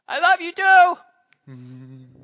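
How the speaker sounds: put-on high, loud, slow drawn-out child voice; child's loud reply. Son calling back his goodnight, loud and drawn out. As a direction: belt it out like a happy little boy. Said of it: "from wife to son".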